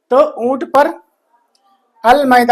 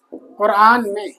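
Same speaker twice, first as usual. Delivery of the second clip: ordinary talk, nothing marked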